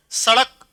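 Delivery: सड़क with a quick retroflex flap ṛ; सड़क with ड़ flapped, never a hard ḍ. Shown as a demonstration. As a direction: flick the tongue out in सड़क, "sa-ṛak". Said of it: "उच्चारण में फर्क आया".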